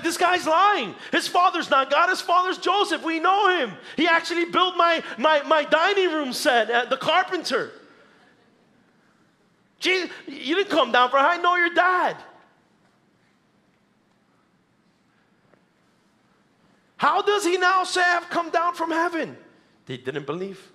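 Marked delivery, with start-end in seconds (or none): 0.0-7.7 high, loud scoffing disbelief; grumbling crowd. Acted. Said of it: "They started grumbling about him". 9.8-19.4 high, loud indignant challenge; grumbling crowd. Acted. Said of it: "They started grumbling about him".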